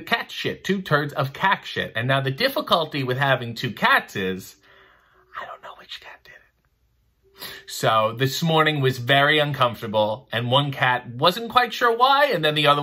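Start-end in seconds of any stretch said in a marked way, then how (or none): 5.4-7.5 hushed unvoiced whisper of the secret. Real, not acted. Host telling viewers about the cat mess, whispered.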